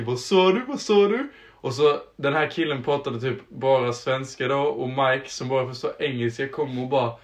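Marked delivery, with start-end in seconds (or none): 0.0-1.3 mock yell, pitched up and climbing; retold yell. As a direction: repeat it like a half-hearted shout. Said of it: "hur han skriker". (låtsasskrik som stiger)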